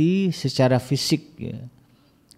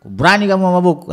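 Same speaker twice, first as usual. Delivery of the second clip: higher and louder, stern; a parent's stern, raised-voice challenge; firm angry rebuke; 10 semitones higher, 8 dB louder than usual. Shown as a demonstration. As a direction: confront the child hard.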